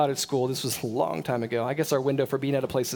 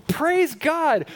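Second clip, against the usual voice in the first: higher, louder shout of praise; 12 semitones higher, 5 dB louder than usual. Real, not acted. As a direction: proclaim Praise God to the room. Loud exclamation in preaching.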